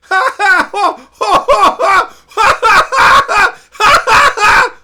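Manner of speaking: very loud high screaming canned laughter; over-the-top mock laugh-track roar; hysterical fake audience laugh. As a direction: howl like a crazed studio audience.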